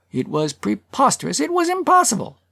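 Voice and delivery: building to a high-pitched protest; indignant, rising to a peak; indignant climax. A narrator's reading. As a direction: end high on an incredulous exclamation. Speaker's indignant climax in speech.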